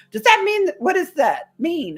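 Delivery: very high, worried question, slowing at the end; anxious friend. Retold or imitated. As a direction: pitch the voice way up, fretful and unsure. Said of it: "And she goes".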